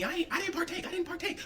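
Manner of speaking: high, prim innocent denial; mock innocent. Real, not acted. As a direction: put on a squeaky little innocent voice.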